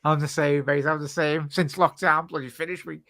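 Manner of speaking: usual speaking voice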